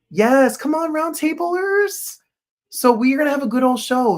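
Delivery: high, stretched cheer to the chat; excited call-out. Real, not acted. Greeting chat regulars with a high excited cheer. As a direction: call out to the fans with glee. Hyping up his chat audience.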